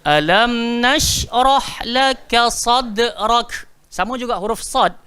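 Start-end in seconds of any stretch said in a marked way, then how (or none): 0.0-3.7 louder, counting in, then very slow chant; leading group recitation. Shown as a demonstration. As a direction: lead the class: count in and recite together. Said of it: "Baca ramai-ramai".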